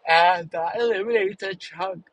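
words stretched through a yawn. Real, not acted. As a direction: say it mid-yawn, jaw wide open. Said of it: "sorry, I'm yawning".

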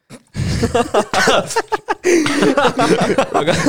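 high, loud burst of laughing; loud laughter. Real, not acted. Reaction to panda mascot name. (luuts, höchs Glächter)

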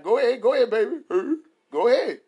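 high, loud cheering on; excited cheering. Retold or imitated. Dad excitedly cheering on his child, high and loud. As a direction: hype the kid up.

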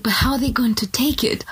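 whispered worry over how it lands; anxious whisper. Retold or imitated. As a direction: hush it like a nervous secret. Voicing a hesitant believer.

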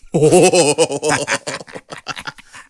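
long, loud hooting "ooh" that wobbles; whooping laugh. Real, not acted. Podcast host reacting to his friend's wife joke, whooping loudly. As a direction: hoot long and loud at the punchline.

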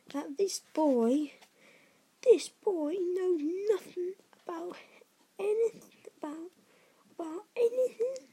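high, incredulous outrage; growling outrage. A narrator's reading. Hagrid confronting the Dursleys about the boy, outraged. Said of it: "he growled at the Dursleys".

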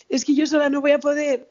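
high plaintive imitation of mum; tearful.

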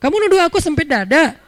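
high, loud offended challenge; nagging wife. Acted. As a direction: play a wife taking offence at the prayer. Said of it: "Istri ngomel".

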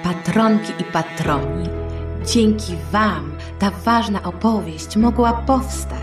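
usual speaking voice

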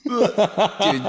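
high, laughing out the words. Real, not acted. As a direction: crack up in disgust. Laughing reaction to gross joke.

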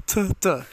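"T, d" loudly sounded as tuh, duh; contrasted t and d. Shown as a demonstration. Teacher inviting the listener to try, "T, d" as tuh, duh. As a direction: model "T, d" as two separate sounds. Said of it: "Try it now, listener".

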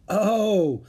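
high, loud "oh"; sudden realization. A narrator's reading. Annie understanding Peanut's message, sudden realization. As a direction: let the penny drop aloud.

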